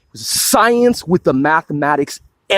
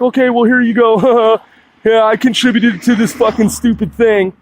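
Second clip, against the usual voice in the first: higher-pitched mock goofy voice; mock-cheerful "here you go" with a goofy laugh; mocking gullible donor; 4 semitones higher than usual. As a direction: imitate a dopey, chuckling donor. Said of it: "they're just like".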